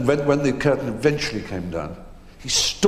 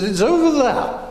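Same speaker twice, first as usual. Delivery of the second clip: high, loud, grande dame voice; airy theatrical lady pointing the way; grand old actress; 7 dB louder than usual. Retold or imitated. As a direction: sail it out like a stage dame, laughing. Edith voiced in anecdote.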